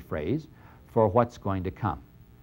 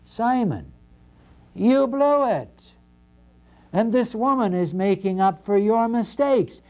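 much higher, louder rebuke of the host; stern rebuke; 10 semitones higher, 3 dB louder than usual. Acted. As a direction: call him out hard, hammering each word. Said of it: "Jesus starts saying".

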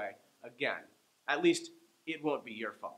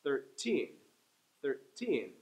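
put-on thirteen with the teen stressed; thir-TEEN, second syllable strong; slower than usual. Shown as a demonstration. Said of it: "the teen gets the stress".

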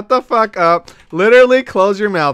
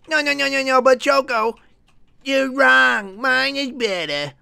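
high, soft mocking whine; squeaky caricature, dragging out the last words; imitating an objector; 7 semitones higher, 3 dB quieter than usual. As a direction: whine the protest in a high put-on voice. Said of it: "this is how he does it".

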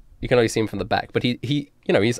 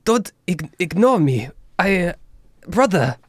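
pitched up, pleading younger brother; higher pleading voice; 6 semitones higher than usual. Acted. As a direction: beg him not to ignore you. Louis pleads with old man.

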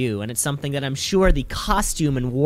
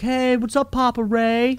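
imitated yelling; 9 semitones higher than usual. Wrestler Seth Rollins taunting at the camera, mock yell. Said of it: "of him yelling".

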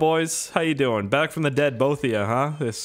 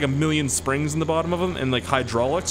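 ordinary talk, nothing marked

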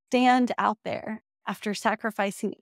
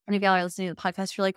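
ordinary talk

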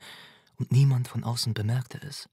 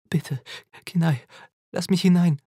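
raised, louder desperate plea; pleading; 6 semitones higher, 6 dB louder than usual. A narrator's reading. Mother begging her son to let her in, pleading. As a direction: beg urgently, building in pitch and volume. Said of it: "flehte ich".

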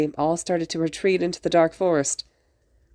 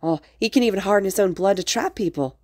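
ordinary delivery

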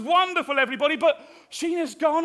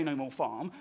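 low mock quote; 13 semitones lower, 10 dB quieter than usual. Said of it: "If you didn't say".

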